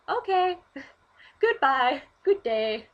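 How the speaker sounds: loud, cheery mock politeness; chirpy guy. Mimicking the polite guy leaving, cheery. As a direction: chirp it brightly. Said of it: "At least he was like".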